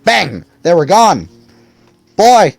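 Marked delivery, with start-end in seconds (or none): 2.2-2.5 put-on high, loud boyish yell. A narrator's reading. Will yells at the emptied streets. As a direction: call out loud to the town. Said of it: "yelled Will".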